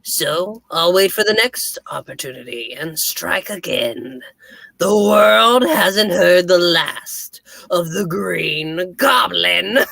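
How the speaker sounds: slow, gloating Green Goblin menace; chilling villain voice. Acted. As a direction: sneer it out like a comic villain. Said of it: "I have a chilling villain voice".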